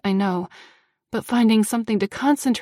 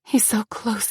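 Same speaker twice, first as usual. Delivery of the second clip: ordinary delivery, nothing marked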